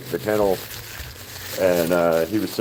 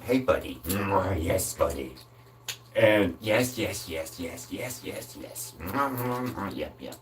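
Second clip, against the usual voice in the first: hushed, much softer cooing and kisses; 10 dB quieter, slower than usual. Real, not acted. Owner cooing to his dog Ziggy, hushed baby talk. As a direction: coo softly at the puppy, barely above a breath.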